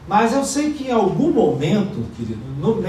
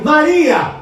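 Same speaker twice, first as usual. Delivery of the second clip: "Maria!" called out high and loud; loud sharp call of the name; 9 semitones higher, 7 dB louder than usual. Shown as a demonstration. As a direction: call her name sharply to snap her back. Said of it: "Gente, assim mesmo".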